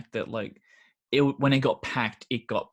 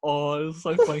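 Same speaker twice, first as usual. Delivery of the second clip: ordinary talk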